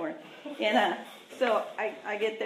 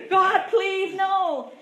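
higher, louder, mock panicked prayer; scared plea; 8 semitones higher, 4 dB louder, much slower than usual. Seeing police lights behind her car, half-joking frightened prayer. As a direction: beg God as the cop lights flash. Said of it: "the reason I was so scared".

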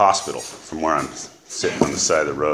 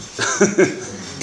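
rueful laugh; 13 semitones higher than usual. Real, not acted. Laughing while telling the story.

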